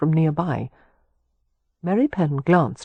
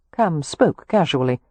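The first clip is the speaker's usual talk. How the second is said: plain reading voice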